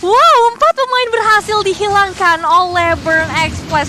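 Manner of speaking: loud, slow, drawn-out hype call; excited shout. Real, not acted. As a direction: belt out the big moment. Caster reacts to four kills.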